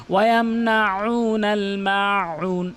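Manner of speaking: slow chant with long held vowels; careful tajweed, throaty ayn; Quran recitation. Shown as a demonstration. Teacher recites next verse, slow measured chant.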